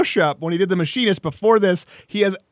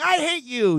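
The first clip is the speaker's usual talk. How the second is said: put-on teenager's outburst; 9 semitones higher, slower than usual. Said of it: "just like a teenager saying".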